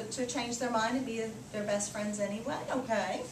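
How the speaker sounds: ordinary talk, nothing marked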